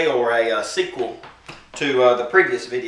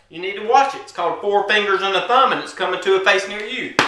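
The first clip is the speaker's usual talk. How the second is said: higher-pitched threatening punchline; mock movie pitch; 7 semitones higher than usual. Storyteller pitching a punch as a movie, high mock promo.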